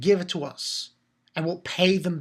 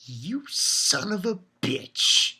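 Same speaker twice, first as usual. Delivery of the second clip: slow, drawn-out curse of disgust; disgusted reaction; much slower than usual. Retold or imitated. A fan cursing the villain, slow and disgusted. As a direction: spit it out slowly, in contempt. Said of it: "I'm like".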